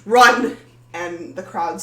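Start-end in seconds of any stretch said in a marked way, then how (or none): 0.1-0.6 high, much louder choked yell of terror; choked shout. Acted. Maddie screams at the townsfolk to flee, choked loud yell. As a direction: scream the warning to the whole crowd. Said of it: "loud choked".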